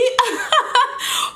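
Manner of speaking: high-pitched giggling burst; amused laugh. Real, not acted. Storyteller laughing at her retort to the neighbor, amused.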